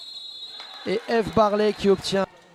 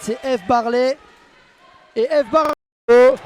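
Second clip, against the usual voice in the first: louder, drawn-out name call; excited sports commentary; 4 semitones higher, 5 dB louder, slower than usual. Real, not acted. Interception and goal call.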